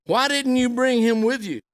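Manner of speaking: high, pressing demand. Acted. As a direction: insist on an answer. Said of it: "who demanded".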